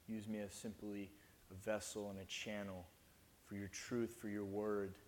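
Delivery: hushed opening prayer before preaching. Acted. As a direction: pray it gently and reverently.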